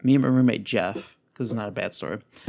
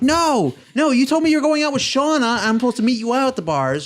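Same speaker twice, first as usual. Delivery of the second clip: high, raised-voice objection; acted-out outraged whine, pitched way up; indignant protest; 11 semitones higher, 3 dB louder than usual. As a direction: argue back at a partner who broke plans. Retold quarrel with Katie over plans.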